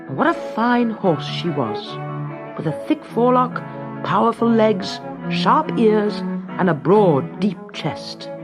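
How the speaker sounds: ordinary delivery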